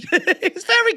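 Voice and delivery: high falsetto pleading; mock high-pitched begging; squeaky son voice. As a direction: plead with Papa like a boy. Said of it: "sound like a British fourteen-year-old".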